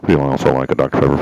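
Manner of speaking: loud, fast drive-thru speaker voice; like a crackly drive-thru intercom. Retold or imitated. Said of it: "this is what they sound like".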